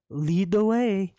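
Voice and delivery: sarcastic. Acted. Player sarcastic.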